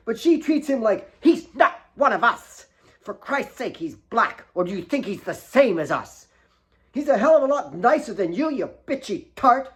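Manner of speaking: put-on high, loud teenage tirade; girl ranting to her father about her sister; angry outburst.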